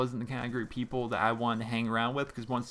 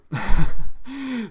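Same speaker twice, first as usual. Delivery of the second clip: loud, high real laugh; burst of laughter; 11 semitones higher, 16 dB louder than usual. Real, not acted. Host amused by the squeaky chair, loud burst of laughing. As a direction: crack up suddenly and loudly.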